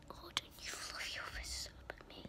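hushed mock telling-off; whispered scolding. Girl whispers a mock scolding at a pigeon. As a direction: whisper a playful warning.

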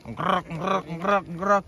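rhythmic ngkerek ngkerek, copying the creak of a kite; kite rattle noise. Shown as a demonstration. Showing viewers the kite's rattling sound. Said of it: "bunyi kayak gini".

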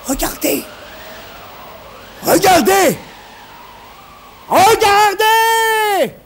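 high cry building to a long scream; crazed shouting. A narrator's reading. Reedus waves live wires at the monster, crazed building shouts. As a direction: shout wilder each time, like a madman. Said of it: "Reedus criait et riait comme un fou".

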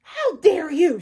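loud, offended outburst; loud indignant dodo shopkeeper; snarl. A narrator's reading. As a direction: bristle with outrage. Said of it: "snarled Coco Dodo".

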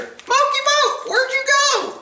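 high falsetto pet call; calling the cat. Real, not acted.

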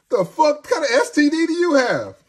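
high, loud laughing shout; real laughing outburst. Real, not acted. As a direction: blurt it out through laughter. Laughing at own joke.

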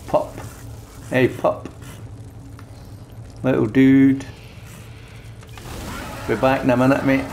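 ordinary talk, nothing marked

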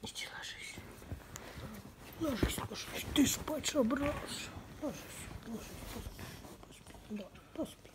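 whispered coaxing to a pet. Real, not acted. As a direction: coax the pet to sleep in a whisper. Putting the pet to sleep.

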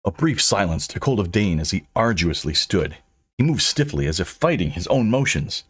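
ordinary delivery

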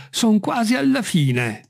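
high, slow, falling statement; Jupiter reporting progress. A narrator's reading. Servant out on the branch reporting to master, calling. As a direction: announce it from up high, drawn out.

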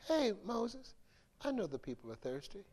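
soft, fast, reassuring instructions; calm him down with quiet reassurance; gentle God. Retold or imitated. God soothing Moses, soft and quick. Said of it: "So God says".

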